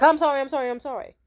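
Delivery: loud, high, hurried pleading; mock panicked apology. Boyfriend backing off as she steps toward him, mock panic. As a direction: blurt it in imitated alarm.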